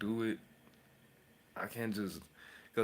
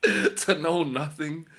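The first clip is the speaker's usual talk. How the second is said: laughing; 8 semitones higher, 13 dB louder, slower than usual. Real, not acted. Laughing at own audacity.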